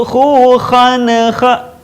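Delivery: "khan" with the n rebounding into a small vowel before "kha"; bouncing N. Shown as a demonstration. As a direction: bounce the n of "khan" instead of holding it. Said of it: "nun mati yang suka mantul". ("khan" dengan nun memantul)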